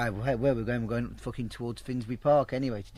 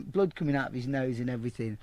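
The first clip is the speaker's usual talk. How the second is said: ordinary delivery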